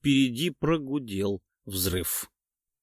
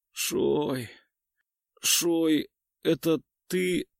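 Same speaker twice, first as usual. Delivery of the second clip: hoarse and strained; strained rasp; 6 semitones higher, much slower than usual. A narrator's reading. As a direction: rasp it out with effort. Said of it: "натужно прохрипел азиат".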